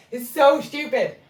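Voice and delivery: exasperated, said out loud; emphatic repeat. Real, not acted. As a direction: punch the word so with disbelief. Repeats remark, now emphatic.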